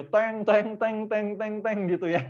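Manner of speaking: "teng, teng" tinggi dan berulang; bunyi bel tiruan. Shown as a demonstration. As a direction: tiru bel pintu yang dipencet terus-menerus. Meniru bel pintu ditekan terus. (menirukan bel pintu dengan nada tinggi)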